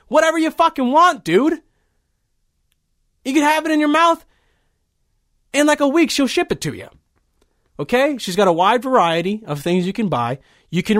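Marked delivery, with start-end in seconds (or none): 0.1-8.2 hyped pitch. Acted.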